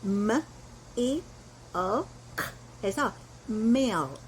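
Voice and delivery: spelled out slowly, ending in a clear milk; slow careful milk, sound by sound; careful pronunciation. Shown as a demonstration. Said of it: "발음 신경 써서".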